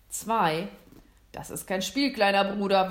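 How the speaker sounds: loud, firm warning count. A narrator's reading. Hunter warns his little brother while counting, warning. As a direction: raise the voice as a stern warning. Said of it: "warnte ihn der Jäger".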